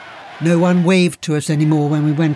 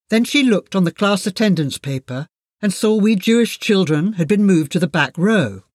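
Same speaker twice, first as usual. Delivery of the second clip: usual speaking voice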